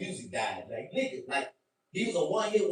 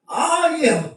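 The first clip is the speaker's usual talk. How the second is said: ordinary delivery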